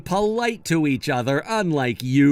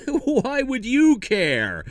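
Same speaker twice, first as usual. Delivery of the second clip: scoffing laugh; 8 semitones higher, slower than usual. Acted. Colin laughs off brother's question.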